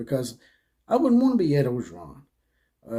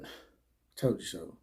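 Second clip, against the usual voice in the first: lower, unhurried told-you-so; slow, smug punchline; 8 semitones lower, 11 dB quieter, slower than usual. Real, not acted. To viewers, host gloats over LSU's new coach, slow and smug. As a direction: drop lower and slow right down, savoring it.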